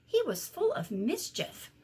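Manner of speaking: ordinary delivery, nothing marked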